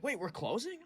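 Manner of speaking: high-pitched mock surprise; pretend shock. Replays pretend shock at closing.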